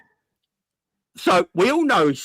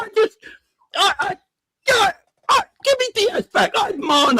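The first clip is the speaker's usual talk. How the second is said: mock wail; 3 dB louder than usual. Mock wailing for Diaz.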